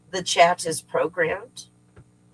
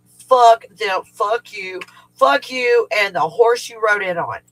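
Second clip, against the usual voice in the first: louder, higher venting outburst; angry cursing; 4 semitones higher, 6 dB louder than usual. Retold or imitated. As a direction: curse it out with emphatic hits. Venting after retelling an argument.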